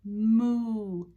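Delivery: long drawn-out moo; cow moo. Shown as a demonstration. Teacher telling children what the cow says, mooing. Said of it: "The cow says".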